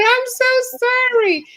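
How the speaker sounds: very high, drawn-out mock-contrite plea; playful exaggerated apology. Woman apologizing for being cranky last night, playfully overdone.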